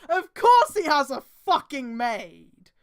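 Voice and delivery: loud, slow exasperation; exasperated outburst. Real, not acted. Reader fed up with the fic's maid, exasperated. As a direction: yell at the page in disbelief.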